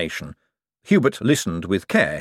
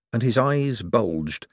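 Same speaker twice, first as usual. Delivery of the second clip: ordinary delivery, nothing marked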